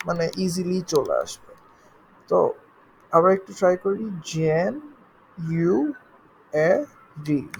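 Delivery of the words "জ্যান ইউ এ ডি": "জ্যান, ইউ, এ, ডি" with a pause after each; syllable by syllable. Shown as a demonstration. Teacher counts syllables for learners, "জ্যান, ইউ, এ, ডি" one at a time. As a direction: lift and separate each piece, "জ্যান... ইউ... এ... ডি". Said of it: "কয়েকটা syllables আছে এখানে".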